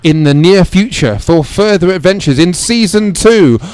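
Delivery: loud, rousing sign-off announcement; serial announcer. Acted. Announcer closing the horror serial for listeners, loud promotional delivery. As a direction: announce the next season at full volume.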